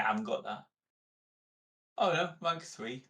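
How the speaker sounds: usual speaking voice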